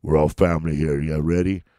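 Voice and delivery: low movie tough-guy impression; Vin Diesel. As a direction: drop the voice low for the action hero. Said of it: "a Vin Diesel".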